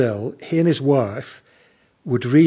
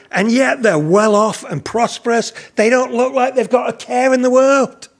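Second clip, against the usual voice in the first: higher-pitched, aggrieved quote of a jealous man; jealous complaint; 7 semitones higher than usual. Retold or imitated. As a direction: complain enviously on his behalf. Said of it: "it made him jealous".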